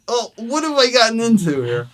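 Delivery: high, loud retold alarm; mock panic at being in over your head; freaking out. Newcomer to himself before the gig, retold freaking out. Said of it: "Now I'm really freaking out".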